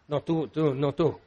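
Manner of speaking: low, quiet nervous aside; hushed reluctance. Acted. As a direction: pass it on under your breath. Discípulos indecisos se pasan la palabra. (en voz baja, con apuro)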